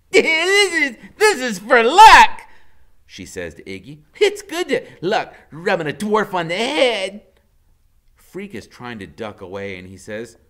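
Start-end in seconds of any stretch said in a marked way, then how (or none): 0.1-7.2 high, loud, giggling; laughing. A narrator's reading. Loretta laughing while rubbing Freak's head, high and loud. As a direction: cackle as you rub.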